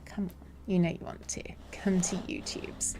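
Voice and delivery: soft, low, conspiratorial whisper; coaxing whisper. Real, not acted. Streamer luring viewers over to YouTube, coaxing whisper. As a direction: whisper it temptingly.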